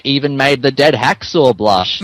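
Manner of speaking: ordinary talk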